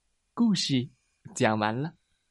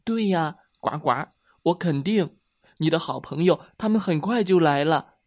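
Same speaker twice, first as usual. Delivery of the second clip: higher, louder frog voice, sure and eager; encouraging little frog; 4 semitones higher, 4 dB louder than usual. Acted. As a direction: reassure a friend with lively certainty. (小青蛙高声鼓励朋友)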